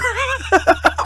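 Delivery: squealing high laugh; real high-pitched laughter; high cackling laugh. Real, not acted. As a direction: break into a shrieking laugh. High laugh after rant.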